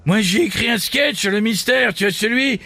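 high, laughing crude comic; Jean-Marie. Acted. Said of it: "un ami des grosses têtes, Jean-Marie".

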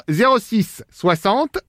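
very slow, syllable by syllable, as if writing it down; slow dictation. Acted. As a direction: spell out the number while noting it. Repeating the number while noting it.

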